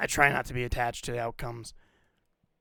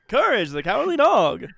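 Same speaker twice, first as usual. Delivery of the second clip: excited; 11 semitones higher, 10 dB louder than usual. Real, not acted. Excited recognition of cartoon.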